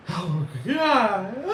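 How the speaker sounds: high-pitched hammed-up wail; mock crying. Crying over someone's foolishness, hammed-up wail. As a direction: break into exaggerated, high sobbing. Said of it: "I'm not crying for me".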